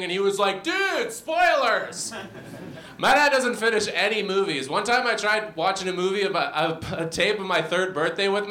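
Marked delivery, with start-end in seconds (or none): 0.6-2.1 high, drawn-out dad outcry; mock indignant protest. Dad to son who spoiled his favorite movie, mock outrage. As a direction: play an aggrieved dad, slow and high.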